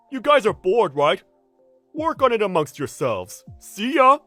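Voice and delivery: loud, high careless send-off; breezy dismissal. Acted. Senior leaving paperwork to two colleagues, breezily dismissive. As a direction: dump the work and go. Senior leaves work to others.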